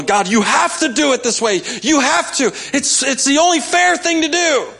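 high, rising insistent plea; high insistent whining at God; demanding whine. Pretend.

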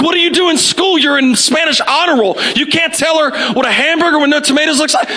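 high-pitched exasperated dad rant; frustrated father berating his kid, played for laughs; elevated voice. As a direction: scold in mock disbelief. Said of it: "we start elevating our voice".

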